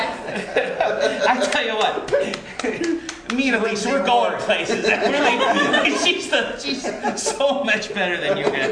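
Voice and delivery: talking through loud laughter; praising Elise while cracking up; laughing. Real, not acted. Laughing after the skit.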